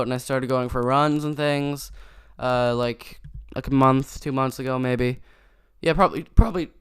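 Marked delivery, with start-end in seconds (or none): 5.8-6.7 voice cracking. Real, not acted. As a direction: voice cracks on the words. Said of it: "voice cracks".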